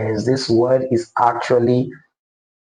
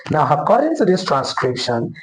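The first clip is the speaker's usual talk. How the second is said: normal talking voice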